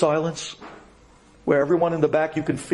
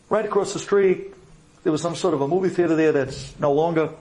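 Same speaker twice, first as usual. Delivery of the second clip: ordinary delivery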